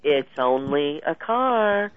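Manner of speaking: very slow, unhurried and steady; the calm inner voice slowly answers the worrier; slow calm reply. Retold or imitated. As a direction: spell it out slowly, like a calm counsellor. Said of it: "another committee member saying".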